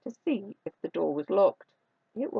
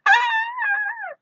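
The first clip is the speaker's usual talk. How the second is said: long shrieking storybook scream; loud high scream; 7 dB louder than usual. A narrator's reading. Three bears frightened by Goldilocks, loud shriek. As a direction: let out a big piercing scream. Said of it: "and they screamed".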